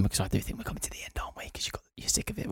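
soft unvoiced whisper. Real, not acted. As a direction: whisper it conspiratorially. Whispered aside.

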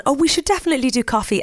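high, fast gushing promise; mock enthusiasm. Mimicking own insincere social promises.

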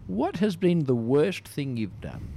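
normal talking voice

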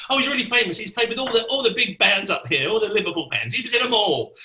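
boasting organizer. Retold or imitated. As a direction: sell the guest as a big name. Organizer hypes the drummer.

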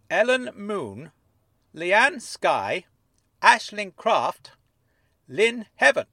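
reading aloud slowly. Acted. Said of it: "Dennis read the names aloud".

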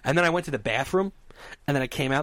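ordinary delivery

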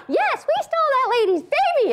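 high-pitched gleeful-sounding mock confession; blurting kid. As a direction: blurt it out like a tattling child.

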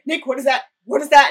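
loud retold panic; mock-frightened questioning; imitated fear. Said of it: "The fear is palpable".

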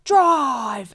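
mock panicked yell, high and loud; doing her friend's screamed drive; imitating a scream. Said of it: "Violet's always the one who's like".

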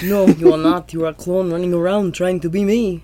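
mock Austrian action-hero voice; Arnold Schwarzenegger accent. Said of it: "I'm the real Arnold".

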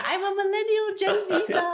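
high laughing mock boast; mocking brother. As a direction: tease in a high silly voice. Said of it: "he's like".

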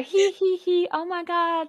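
high, drawn-out mock giggle; mocking gigglers. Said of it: "Yeah, and they go".

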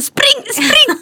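very high, excited call to run; urgent mock shout. Brother urging the children to run from a knocked door, urgent mock shout. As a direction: cry it out high like a getaway signal.

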